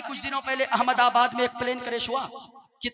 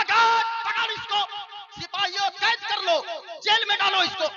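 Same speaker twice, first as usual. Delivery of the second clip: furious king's order; 8 semitones higher than usual. Acted. King orders guards to jail the vizier, high and enraged. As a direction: order the arrest in a rage.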